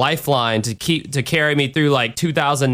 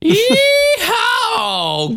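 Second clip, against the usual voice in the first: high cowboy holler yeehaw; wild rambling cackles; 3 dB louder than usual. Acted. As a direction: whoop it up like a cowboy.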